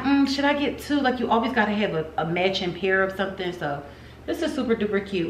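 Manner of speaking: ordinary talk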